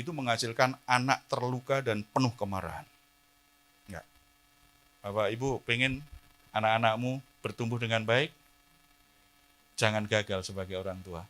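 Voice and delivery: ordinary delivery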